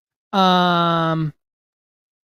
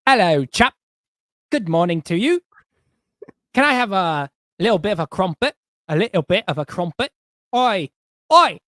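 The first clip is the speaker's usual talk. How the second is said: hammed-up British accent, pitched higher; 5 semitones higher, 5 dB louder than usual. Shown as a demonstration. Host answers viewer's challenge, exaggerated British accent. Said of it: "How well can you do a British accent?".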